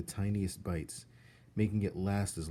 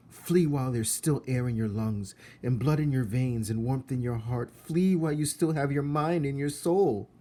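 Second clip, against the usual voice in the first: loud, higher pleading warning; urgent ghostly warning; 6 semitones higher, 7 dB louder than usual. A narrator's reading. Ghost girl begging Coraline to flee, loud urgent warning. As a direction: beg her to run, voice rising.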